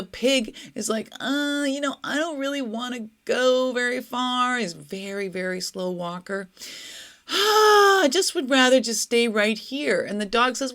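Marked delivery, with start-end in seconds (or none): 1.2-4.6 higher-pitched, dragging reluctance; reluctant pig. A narrator's reading. The pig tells its friend it won't go far, reluctant. 7.3-10.0 big high, loud sigh into the words; weary pig. A narrator's reading. Weary pig prefers to stay.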